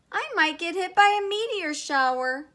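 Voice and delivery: scared, high and loud put-on voice; Uranus. A narrator's reading. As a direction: fret in a high frightened cartoon voice. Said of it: "Uranus was too scared".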